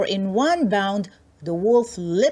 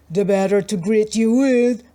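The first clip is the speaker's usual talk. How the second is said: louder sly wolf; wolf in disguise answers the girl, louder; 5 dB louder than usual. Acted. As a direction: purr it like the wolf in the bed. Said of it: "said the wolf".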